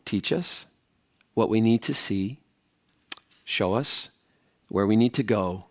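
hushed, low prayer with pauses; spoken prayer. Real, not acted. Preacher prays to Father before teaching the text, quiet prayer. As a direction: pray quietly in short, spaced phrases.

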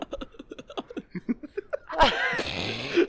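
high squeaky laugh that whirs like a machine; washing machine laugh. Real, not acted. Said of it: "Sound like a fucking washing machine".

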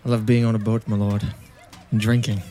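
bad British accent drifting Irish; British accent gone Irish. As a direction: answer the lord in a rough Irish-tinged brogue. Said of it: "you're kinda like Irish or Scottish".

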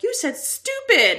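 put-on sing-song it like a tattling child; childish tattling. Kids telling mom which word she said, childish tattling.

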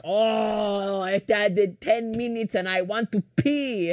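slow, drawn-out complaint; mom impression. Mom moaning about the longer trip, slow mock complaint. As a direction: moan it slowly as a put-on mother. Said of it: "She's like".

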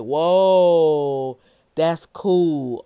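loud, bright-eyed whoa of delight; excited whoa. Shown as a demonstration. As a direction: shout it like you just saw something cool. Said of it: "like a excitement whoa".

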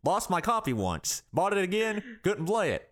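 mock statesman with drawn-in pauses; Obama. Podcaster dismisses the game's supporters, mock-presidential voice. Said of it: "I haven't done my Obama one yet".

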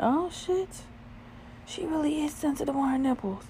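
high-pitched retold amazement; amazed realization. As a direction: act out a stunned discovery. Retold amazed inner reaction.

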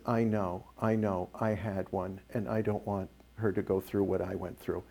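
low, soft retelling of a crying mother; understated sorrow, voice dropped; retold tearful confession. Crying mom confides to clinic volunteer, retold softly with understated sorrow. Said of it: "Mom starts crying, sobbing".

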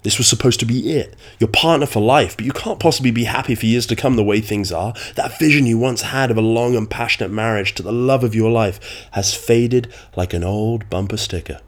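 normal talking voice

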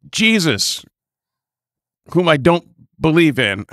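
mock prayer. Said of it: "Let me try it".

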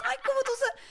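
high mock-proud mother, sweetly; a teasing mom's gushing; doting mom voice. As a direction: gush like a mom praising her boy. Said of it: "en la punta de la lengua te decía".

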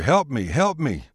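desperate pleading yell; panicked yell. Acted. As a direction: shout for help with a bull at your heels. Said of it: "The agent yelled at the rancher".

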